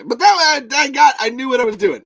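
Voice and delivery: high, loud, fast protest; movie character voice. Retold or imitated. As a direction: blurt it out defensively. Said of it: "in the voice that they sound like from the movie".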